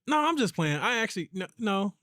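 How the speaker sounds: daughter's fib voice. Retold or imitated. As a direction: squeak out a guilty take-back. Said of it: "once you like".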